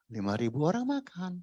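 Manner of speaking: ordinary talk, nothing marked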